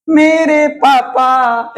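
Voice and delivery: drawn out syllable by syllable; very slow, measured model pronunciation; slow demonstration. Shown as a demonstration. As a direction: stretch each syllable for the class. Teacher models the lip sound.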